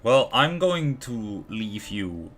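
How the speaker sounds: usual speaking voice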